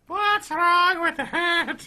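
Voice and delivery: high, slow leprechaun voice; sick leprechaun. Pretend. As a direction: squeak like a little sick leprechaun. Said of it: "Sound like a sick leprechaun".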